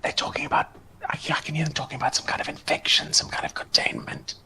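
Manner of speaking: urgent hushed whisper. Acted. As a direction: whisper it close to the door. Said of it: "sort of quietly".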